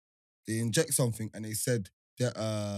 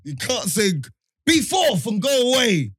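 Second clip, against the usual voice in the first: incredulous shout; 9 semitones higher, 10 dB louder than usual. Retold or imitated. Mocking the women's spell to friend, high loud shout. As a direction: shout it in disbelief. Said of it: "I'm saying".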